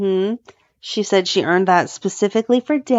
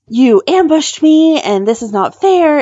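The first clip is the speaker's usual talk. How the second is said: higher, louder mock outrage; angry outburst; 8 semitones higher, 5 dB louder than usual. Judge snapping at the lawyer who ambushed him, higher and louder. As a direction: imitate him blowing up at being cornered. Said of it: "he gets all angry".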